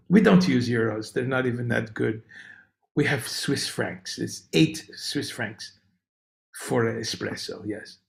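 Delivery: soft, low mock-Swiss accent, matter-of-fact; vague European accent. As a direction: put on an unplaceable European accent, unhurried and deadpan. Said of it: "I don't know what accent that is".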